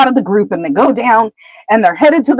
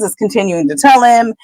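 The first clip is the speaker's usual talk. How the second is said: ordinary delivery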